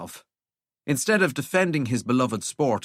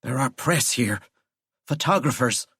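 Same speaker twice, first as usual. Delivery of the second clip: ordinary delivery, nothing marked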